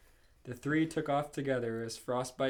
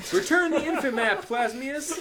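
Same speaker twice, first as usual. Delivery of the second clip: laughing shout; 13 semitones higher, 10 dB louder than usual. Acted. Hero yelling at villain.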